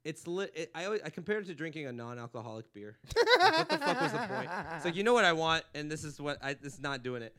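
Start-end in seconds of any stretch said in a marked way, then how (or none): 3.4-4.5 laughing rant. Real, not acted. Ranting about non-alcoholic beer.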